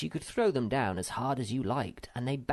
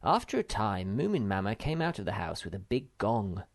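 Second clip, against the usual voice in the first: ordinary delivery, nothing marked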